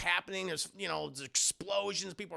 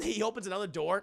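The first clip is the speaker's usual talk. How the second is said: ordinary talk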